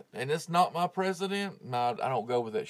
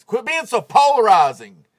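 loud, higher exasperated command; frustrated shout; 8 semitones higher, 14 dB louder than usual. Real, not acted. Podcaster yelling at listeners to quit polarizing, loud and fed up. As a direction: shout it at the whole divided audience.

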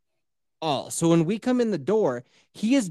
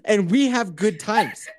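laughing mock voice; 3 semitones higher than usual. Mocking voice, laughing.

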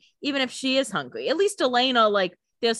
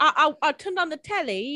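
high, laughing English caricature; mock English accent; 6 semitones higher than usual. Host mimics Catherine in England, jokey English accent. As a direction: put on a silly English accent through a laugh. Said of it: "I guess I'll do the accent".

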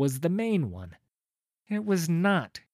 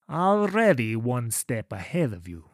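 smug villain; 4 semitones lower, 3 dB louder than usual. Acted. Janek gloats.

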